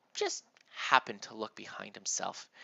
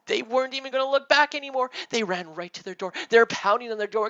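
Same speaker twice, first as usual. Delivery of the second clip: breathless chase narration; 12 semitones higher, 11 dB louder, faster than usual. A narrator's reading. Kids fleeing in panic.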